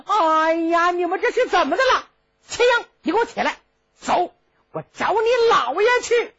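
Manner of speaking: high, quick, scolding prince; angry prince. Acted. Prince drags Qin Ying.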